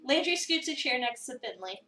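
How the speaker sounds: normal talking voice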